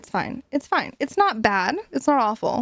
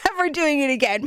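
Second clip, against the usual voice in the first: pouty; 4 semitones higher than usual. Retold or imitated. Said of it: "It's like".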